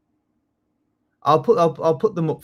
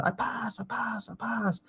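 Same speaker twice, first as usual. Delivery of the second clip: a higher, softer mock student voice; imitating passing students; 8 semitones higher, 10 dB quieter, slower than usual. Teacher mimics students announcing they passed, higher and softer. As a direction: chirp it like each happy student walking in.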